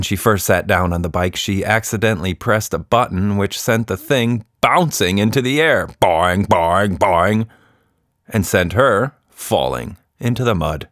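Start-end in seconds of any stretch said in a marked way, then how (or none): 6.0-7.5 springy boing, boing, boing; playful sound-effect boings; bouncy boing sounds. A narrator's reading. Bike bouncing into the air.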